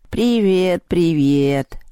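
drawn-out, musing cat voice; reply thoughtfully, stretching each hello; pensive cat. A narrator's reading. The cat answers Lyolya's greeting, pensive cat. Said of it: "задумчиво ответил кот".

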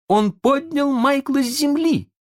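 high-pitched excited exclamation; exclaiming. A narrator's reading. Питер sees a way to save Венди, exclaiming. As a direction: cry out as the idea strikes. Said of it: "воскликнул Питер".

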